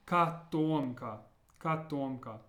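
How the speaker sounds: "Katomka" with a rounded O in the stressed middle syllable; full second O. Shown as a demonstration. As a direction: say "Katomka" as kah-TOM-ka. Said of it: "pronounced more like how we learned it".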